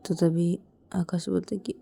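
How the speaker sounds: plain reading voice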